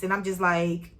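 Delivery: usual speaking voice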